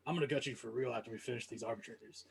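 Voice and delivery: a low, hushed goblin threat; sudden quiet menace. Acted. Goblin Frogward threatening the mercenary after a head pat, hushed menace. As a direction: drop from cheerful to a soft menacing promise. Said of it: "goes from happy to be like".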